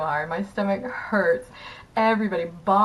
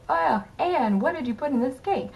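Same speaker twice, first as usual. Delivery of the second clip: higher, lightly voiced stage line; lightly voicing the actress's usual stage line, higher; Marilla's usual line; 8 semitones higher than usual. Actress Marilla asking Anne about the cake, lightly voiced and higher. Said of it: "turn back and go".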